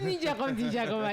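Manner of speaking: high, lightly mock-baffled; puzzled question. [name] asking Diallo what he meant, mock puzzled. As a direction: ask it back half-confused, amused. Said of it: "Je dis".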